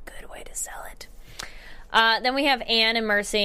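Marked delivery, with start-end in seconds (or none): 0.1-1.1 a soft, breathy aside in a whisper. Shown as a demonstration. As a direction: mutter it to yourself under your breath. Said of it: "That's a good way to sell it".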